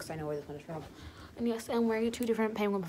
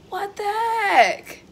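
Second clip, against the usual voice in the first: high, loud, stretched-out shocked cry; shocked shout; 12 semitones higher, 14 dB louder, much slower than usual. Real, not acted. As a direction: yell out in shock at the sudden hail. Startled by sudden hail.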